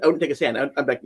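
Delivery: normal talking voice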